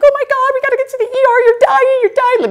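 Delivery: high, loud, fast hysterical wail; frantic wife wailing at her bleeding boyfriend; retold bawling panic. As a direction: shriek it as if he is dying. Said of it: "I'm bawling".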